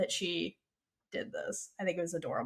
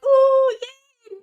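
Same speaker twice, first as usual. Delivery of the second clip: very high squealed ooh yay; mock cheering; 7 dB louder than usual. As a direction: squeal like giddy friends. Friends cheering in background.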